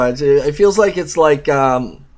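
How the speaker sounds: ordinary delivery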